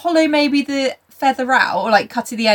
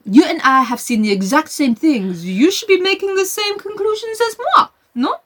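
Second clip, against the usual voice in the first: Poirot; 6 semitones higher than usual. Retold or imitated. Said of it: "then Poirot was like".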